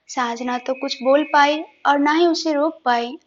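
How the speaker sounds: usual speaking voice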